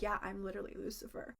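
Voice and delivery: a stranger claims to be Lucifer, sinister and soft; sinister Lucifer line. Retold or imitated. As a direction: hush it like a menacing secret. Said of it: "he just looked so sinister".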